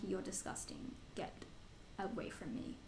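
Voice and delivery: soft, low muttered rejection; muttered disgust. Retold or imitated. Telling bratty kids to get away, soft and low. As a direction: mutter it at a gross kid. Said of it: "other ones that I'm like".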